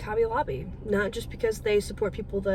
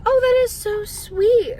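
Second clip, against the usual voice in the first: higher, louder and slow, delighted; gushing; 12 semitones higher, 7 dB louder, slower than usual. Retold or imitated. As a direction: gush over something adorable. Woman moved by her answer.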